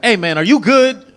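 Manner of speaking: high, loud, slow concerned question; alarmed check-in. Retold or imitated. Strangers asking the fleeing man, alarmed call.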